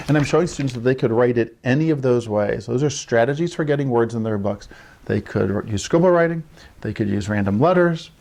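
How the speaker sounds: ordinary talk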